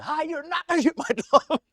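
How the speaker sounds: high, laughing comeback; laughing retort. Retold or imitated. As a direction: fire back through a laugh. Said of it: "I finally said".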